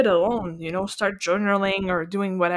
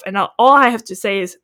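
usual speaking voice

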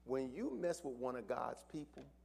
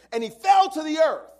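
much higher, louder preaching cry; preaching shout; 21 dB louder than usual. Acted. Pastor narrating Saul's fall to the church, preaching shout.

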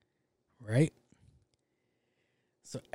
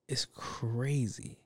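whispered; 3 dB quieter, much slower than usual. Real, not acted. Awed aside about having money.

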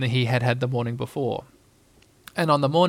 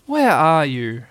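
louder call for a lost friend; anxious cry; 5 dB louder, much slower than usual. A narrator's reading. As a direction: call out as a worried boy. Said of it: "cried Christopher Robin".

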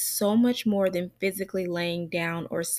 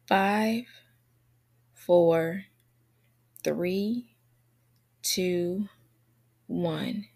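calm grounding exercise; slower than usual. Acted. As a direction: guide them gently through the senses. Leads a grounding breathing exercise.

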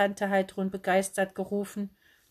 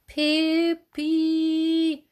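high piercing scream of a name; girl screaming; 9 semitones higher, 7 dB louder than usual. A narrator's reading. Girl screaming after her runaway aunt. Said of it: "schrie ein Mädchen".

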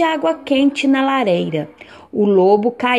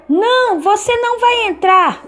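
much higher little pig voice; 11 semitones higher, 3 dB louder than usual. Acted. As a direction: pipe up high like a piglet. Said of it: "os porquinhos responderam".

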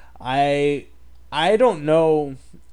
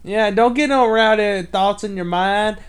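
put-on old prospector; 4 semitones higher than usual. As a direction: play a cranky old-timer. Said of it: "somebody that's, like, seventy years old".

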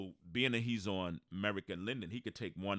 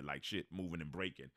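ordinary delivery

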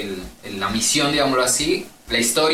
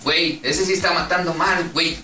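mock Mexican gamer, pitched up; Mexican accent; 5 semitones higher than usual. Gamer quoting Mexican players on the open mic, mock Mexican accent. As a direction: put on a Mexican accent to grumble.

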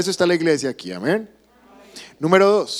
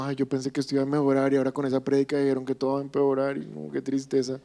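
softer, fast, moping complaint; mock gloomy whine; 2 semitones lower, 6 dB quieter, much faster than usual. Churchgoer leaving service, moping in soft fast whine. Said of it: "salir de la iglesia como".

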